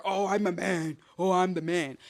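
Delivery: lightly voiced manly caricature; hint of a put-on man voice; mock macho man. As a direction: play a tough guy a little. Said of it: "masculine energy doesn't have to always be".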